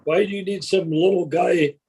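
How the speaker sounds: ordinary talk, nothing marked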